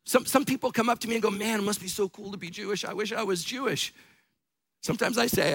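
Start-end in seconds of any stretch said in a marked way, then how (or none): 1.3-3.9 gushing admirer. Retold or imitated. Imitating admirers who come up.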